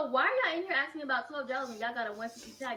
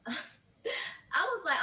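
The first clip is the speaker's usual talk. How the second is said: laughing; 7 semitones higher, much slower than usual. Real, not acted. Laughs mid retelling.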